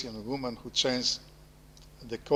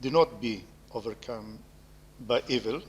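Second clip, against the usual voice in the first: scripture reading. Acted. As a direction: read the verse solemnly, with pauses. Reads Paul's epistle to close.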